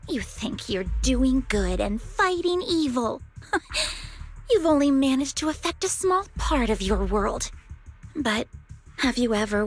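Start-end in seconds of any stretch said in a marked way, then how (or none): 3.3-4.5 short, high breath of a laugh; a quick, soft scoffing laugh; scornful laugh. Acted. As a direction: let out a high, derisive huff.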